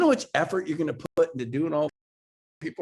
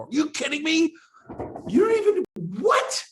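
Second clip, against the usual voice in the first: retold outburst; 13 semitones higher than usual. Boss scolding his star agent, outraged and shrill. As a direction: explode in outraged disbelief. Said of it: "I have never been so mad".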